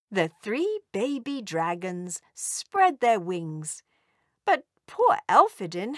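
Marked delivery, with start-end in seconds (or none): none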